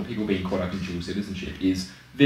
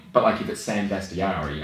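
usual speaking voice

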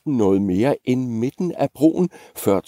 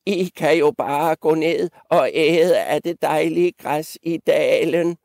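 put-on old billy goat; 8 semitones higher than usual. Old goat suggesting the meadow to the three goats, in a high creaky-old-goat voice. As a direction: speak up as an elderly goat, pitched high. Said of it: "sagde en af de ældste gedebukke".

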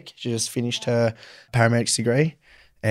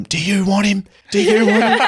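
much higher, laughing mock offer; exasperated mum; 9 semitones higher than usual. Mum offering her son to the old ladies, high and laughing. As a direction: hold the kid out to strangers, laughing. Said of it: "She's like, turned them".

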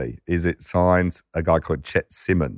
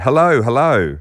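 loud, higher brash phone greeting; loud brash hello; 6 semitones higher, 5 dB louder than usual. Retold or imitated. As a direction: boom it out like a brash caller. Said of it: "it's this loud, brash voice".

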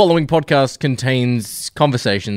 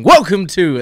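loud booming welcome, higher; announcer intro; 8 semitones higher, 5 dB louder than usual. Retold or imitated. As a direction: call it out like a show host. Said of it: "by saying".

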